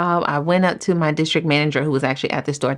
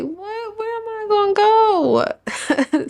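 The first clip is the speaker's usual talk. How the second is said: very high, drawn-out, tearful whine; squeaky half-crying panic; retold panicked wail; much slower than usual. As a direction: wail it slowly in helpless dismay. Retold panic at losing salon.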